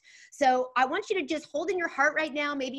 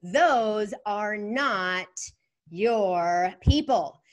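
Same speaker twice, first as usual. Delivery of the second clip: drawn-out words, louder on people; very slow, drawn out; 3 semitones lower, 4 dB louder, much slower than usual. Shown as a demonstration. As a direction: stretch every word slowly. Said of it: "finish the second half of this".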